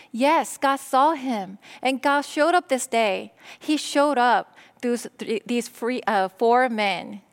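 ordinary delivery

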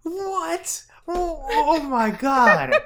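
very high, loud what, then a slow laughing oh my God; shrieking disbelief. Real, not acted. Hearing the eBay price, a shrieking laughing disbelief. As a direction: squeal at the absurd price.

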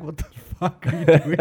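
mock a dad's disbelief through a laugh; dad's reaction. Son retells dad hearing about French immersion, mock-baffled. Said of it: "Dad's like".

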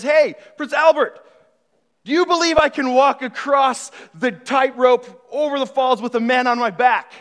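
slow, high call across the falls. Retold or imitated. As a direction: shout up to the prince from the rope. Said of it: "Charles spots him and says".

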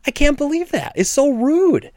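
high-pitched, incredulous indignation; indignant disbelief. Retold or imitated. Store owner reacting to a rude customer driving off, indignant. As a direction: pitch jumps way up in offended disbelief.

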